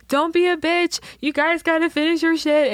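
high-pitched, pestering mock taunt; hammed-up annoying peer pressure; annoying goading. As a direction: needle your friends in a high voice. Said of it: "me being annoying".

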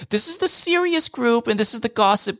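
high, mock-official announcing; mock organiser. Mocking chat organisers, high fussy announcing.

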